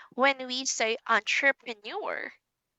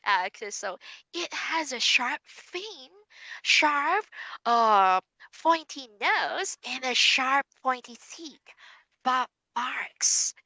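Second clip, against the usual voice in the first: put-on lively, higher storybook reading for a young learner; reading to a child; 5 semitones higher than usual. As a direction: bring the shark story alive for Sandy.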